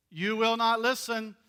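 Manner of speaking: ordinary talk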